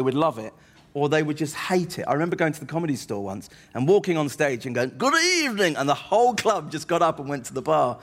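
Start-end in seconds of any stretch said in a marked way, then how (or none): 5.0-5.8 put-on old theatrical lovey. Comic walking on stage as the lovey, theatrical greeting. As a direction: sing out a grand luvvie entrance, pitch soaring. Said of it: "he's this old theatrical lovey".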